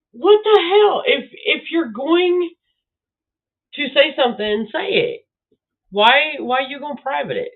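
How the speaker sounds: high, incredulous outburst at the screen; exasperated. Retold or imitated. Annoyed viewer scolds uploader: incredulous outburst. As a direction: vent at the uploader who hid it. Retelling own annoyed reaction.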